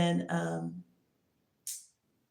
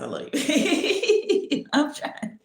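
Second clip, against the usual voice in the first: high, slow, laughing apology; 9 semitones higher, 4 dB louder, much slower than usual. Real, not acted. Telling God she loves him while laughing.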